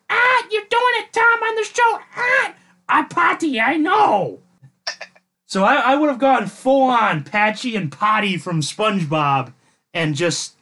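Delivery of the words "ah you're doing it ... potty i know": hammed-up high, loud pirate voice; pirate talk. Podcast host showing his guest his pirate punishment, hammed-up pirate voice. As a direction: growl it like a cartoon pirate, pitched up and loud. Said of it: "started talking like a pirate".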